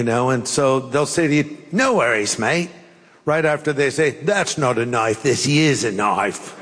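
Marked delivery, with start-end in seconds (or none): 1.7-2.7 put-on Australian accent, easygoing and carefree; playful mock-Australian lilt. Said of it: "my accent's falling apart as I do it". 4.2-6.5 hammed-up Australian accent, pitched low; amateur Australian impression, deep and slowing. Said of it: "my accent's falling apart as I do it".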